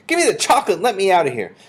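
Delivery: fast, high, loud demand; boy's shout. A narrator's reading. Suspicious Bruno wants to get out, shouting fast. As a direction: yell it in an urgent rush. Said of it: "shouted Bruno".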